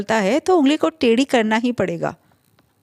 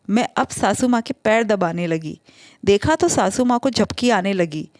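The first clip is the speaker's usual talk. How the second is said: usual speaking voice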